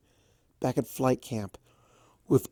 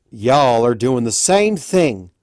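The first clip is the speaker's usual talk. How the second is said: louder, slow and stern; stern scolding; 9 dB louder, slower than usual. A narrator's reading. Applejack scolding both sides, slow and stern. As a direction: lay it down slow and hard. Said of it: "still glaring at the blue stallion".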